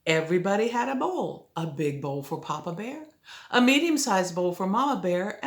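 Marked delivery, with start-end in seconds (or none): none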